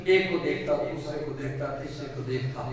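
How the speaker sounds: drifting into a hush, bottle after bottle; fading away. A narrator's reading. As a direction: trail off as the eyes move along the shelf. Old man eyeing bottles one by one.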